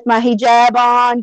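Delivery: normal talking voice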